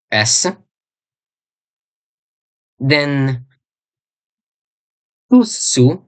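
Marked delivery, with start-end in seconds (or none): 5.3-6.0 tusu with a long held double S. Shown as a demonstration. Teacher modelling tusu for learners, long double S. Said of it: "Make sure you pronounce double S".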